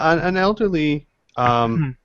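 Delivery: ordinary delivery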